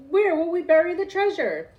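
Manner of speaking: loud, high desperate cry; hollering. A narrator's reading. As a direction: holler it over the storm. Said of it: "hollered Braidbeard".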